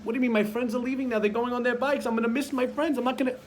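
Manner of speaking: put-on a child whining at the parent about cleaning; whiny kid. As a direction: protest like a kid kept from friends. Teen protesting chores.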